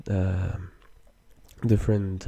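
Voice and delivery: ordinary talk, nothing marked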